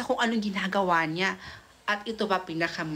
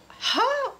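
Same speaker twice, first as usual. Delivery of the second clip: shocked ha. Retold or imitated. Gulat sa pag-amin.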